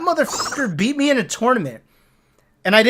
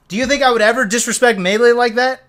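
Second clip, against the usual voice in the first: usual speaking voice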